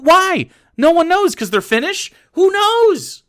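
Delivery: loud, high-pitched exasperated outburst; exasperated yelling. Real, not acted. Streamer ranting about telling Nordic countries apart, exasperated yelling. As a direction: yell it at the top of your range, incredulous. Ranting that nobody knows the difference.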